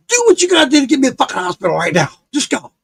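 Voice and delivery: fast, high angry outburst; mad. Retold or imitated. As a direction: rattle off the order, fed up. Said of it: "I was so mad".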